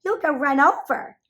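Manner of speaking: a frightened duck mother warning; flustered warning. A narrator's reading. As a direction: scold in a dither, still shaken. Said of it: "all of a dither".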